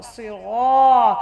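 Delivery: "सिरा" slow, the last syllable full and held; heavy "siraa". Shown as a demonstration. Teacher shows the thickened letter ra, heavy drawn-out "सिरा". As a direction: say "सिरा" with a thick r and long vowel. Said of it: "थोड़ा सा मोटा करो".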